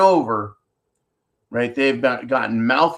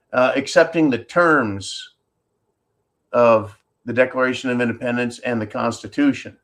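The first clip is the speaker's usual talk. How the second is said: ordinary talk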